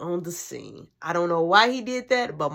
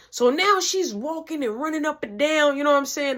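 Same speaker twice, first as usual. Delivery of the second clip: ordinary delivery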